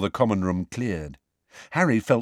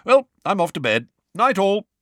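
raised, self-important sign-off; pompous; 9 semitones higher, 4 dB louder than usual. A narrator's reading. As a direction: take your leave pompously, pitched high. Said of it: "shaking his head pompously".

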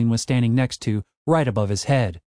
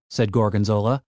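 ordinary delivery

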